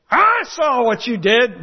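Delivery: mock accusing God. What God did not say.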